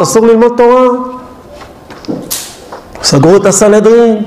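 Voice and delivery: ordinary delivery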